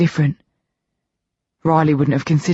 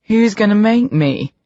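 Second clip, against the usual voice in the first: slow, louder menacing challenge; growl; 6 semitones higher, 3 dB louder than usual. A narrator's reading. Rage challenging B to a fight, slow threatening growl. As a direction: square up and dare him. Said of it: "Rage growls".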